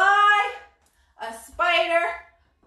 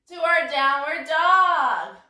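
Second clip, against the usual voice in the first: ordinary talk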